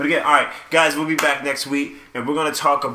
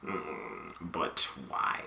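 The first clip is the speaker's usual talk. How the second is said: much lower, much softer mock of a friend's voice; imitating Steven; 11 semitones lower, 15 dB quieter, much slower than usual. Host imitating friend Steven's voice, low and murmured. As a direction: drop low and murmur it, mocking. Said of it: "Steven be like".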